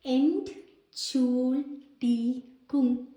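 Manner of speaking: Entschuldigung drawn out very slowly, syllable by syllable; Entschuldigung stretched out slowly, every syllable clear; slow teaching demo. Shown as a demonstration. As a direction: spell Entschuldigung out slowly for learners. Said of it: "അതാണ്".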